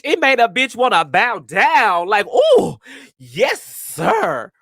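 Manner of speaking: high, loud swooning gush. Retold or imitated. Friend recalling Tony the Tiger, high swooning gush. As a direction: swoon over the muscular man. Awestruck retelling of crush.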